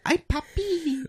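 very high-pitched put-on Spanish-accented squeal; mock Spanish accent. Host teasing friend about accents he likes, mock Spanish accent. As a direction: squeal it like a caricatured Spanish girl. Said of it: "You wanna hear that".

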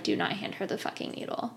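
fast, whispered order to an ally; retold warning under the breath; urgent secret whisper, re-enacted; hushed warning. Secret warning look to sister.

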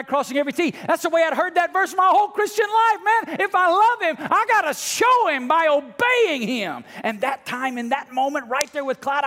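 high, loud, driving preacher's cry; full-force preaching. Acted. Pastor pressing the congregation at full force. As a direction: preach it out, rising to a peak.

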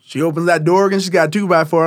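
ordinary talk, nothing marked

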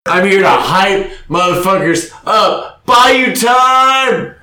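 energy drink ad. Pretend. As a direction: pump up the crowd like an announcer. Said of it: "I'm the hype man".

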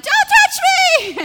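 sudden high, loud, slowed yelp; mock shriek. Wounded person flinches from a thumbnail, hammed-up shriek. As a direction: shriek it like a raw wound was touched. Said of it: "and you're like".

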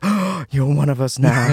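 gasping, drawn-out delighted welcome; mock awe. Friends welcoming him after he says pop, mock-awed delight. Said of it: "looked at me with the brightest eyes".

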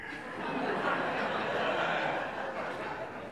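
silent breathy laugh at his own joke; real quiet laugh at his own joke. Real, not acted. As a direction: laugh under the breath at the punchline.

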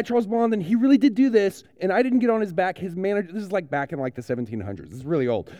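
normal talking voice

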